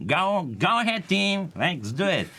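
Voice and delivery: high, pushy cheerleading boss; nagging boss voice. Retold or imitated. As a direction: pump up the team like an annoying boss. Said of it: "boss of yours who is always like".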